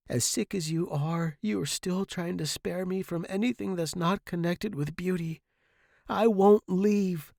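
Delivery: high, earnest devotion; tender insistence. A narrator's reading. Student hugging sick professor, devoted.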